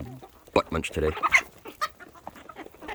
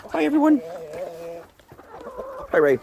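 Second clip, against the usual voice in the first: high greeting; 8 semitones higher than usual. Real, not acted. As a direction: greet the flock brightly up high. Greeting the birds.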